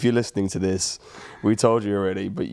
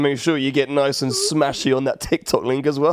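advert voice; 5 semitones higher than usual. Acted. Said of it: "You're amazing at adverts as well".

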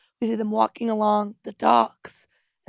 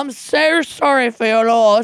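put-on Australian accent; 7 dB louder than usual. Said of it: "She said".

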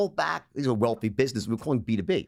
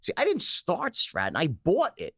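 normal talking voice

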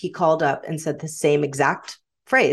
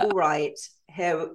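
casual Estuary accent, trailing softer; Estuary English accent; 4 dB quieter, slower than usual. Shown as a demonstration. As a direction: drop into relaxed Estuary English. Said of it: "like, estuary accent".

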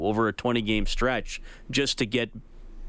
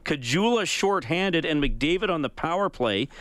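upbeat, higher host read over music; announcer voice opening the broadcast; radio show open; 6 semitones higher than usual. Acted. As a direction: kick off the show with energy.

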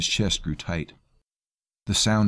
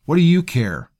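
high, loud angry retort; 10 semitones higher, 9 dB louder than usual. A narrator's reading. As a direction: fire it back at him. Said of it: "Maggott retorted".

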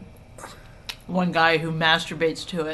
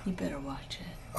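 low whispered warning; barely audible playful menace; whispered mock threat; 6 semitones lower, 12 dB quieter than usual. Mock threat back at teasing husband.

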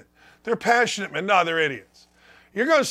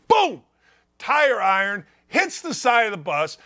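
ordinary talk, nothing marked

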